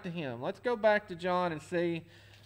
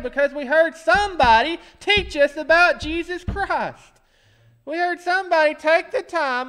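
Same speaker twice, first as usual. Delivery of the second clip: loud, higher preaching; raised pulpit voice; full-force preaching; 6 semitones higher, 8 dB louder than usual. Acted. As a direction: preach it out to the congregation.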